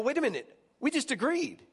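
hammed-up wait, what; high-pitched incredulous realization. Exasperated retelling of argument.

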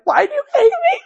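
put-on high, loud wail of a hurt kid; upset child. Mom imitating daughter's dramatic reply, high and loud. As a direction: cry it out like a wounded little girl. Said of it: "the other one's like".

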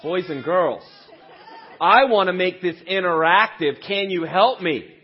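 put-on big energetic repeat to the kids. Shown as a demonstration. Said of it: "Okay, that's better".

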